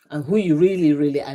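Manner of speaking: ordinary talk, nothing marked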